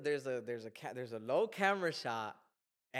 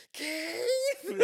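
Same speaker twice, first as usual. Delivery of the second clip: very high, loud mock scream; piercingly high, drawn-out yell; imitated scream; 11 dB louder than usual. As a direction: scream out the name like a horror-film heroine. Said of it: "just scream it".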